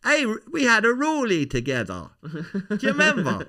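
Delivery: high, loud, insistent Irish comic voice; Tommy Nicholson. Acted. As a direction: pester the star with excited memories. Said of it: "That's Tommy Nicholson".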